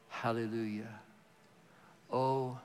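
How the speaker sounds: ordinary delivery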